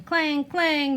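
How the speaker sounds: high, loud clanging cry; loud clang call. A narrator's reading. Reading to kids, calling the clang loudly. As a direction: ring it out like pipes.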